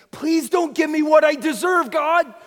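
loud, high shouted plea; the tax collector crying out, loud and high; desperate cry. Acted. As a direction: cry out to God for mercy.

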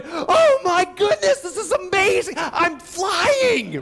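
very high, loud gushing wonder; mock rapture, slowed and shouted; amazed flyer. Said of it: "sitting there going".

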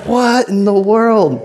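high, drawn-out incredulity; retold slow, baffled exclamation; mock bafflement. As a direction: stretch it out in comic astonishment.

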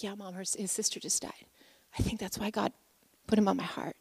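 very soft, subdued explanation; grave hush. Retold or imitated. Son explaining to mom why God moved him, hushed. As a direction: confide it in a near whisper.

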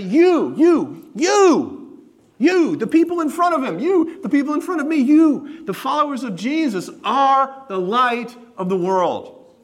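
each "you" punched high and loud, held out; insistent, rising repeats of "you", each one stressed hard; emphatic YOU. Shown as a demonstration. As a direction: hammer "you" at the congregation, pitched far up. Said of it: "He adds the pronoun to emphasize".

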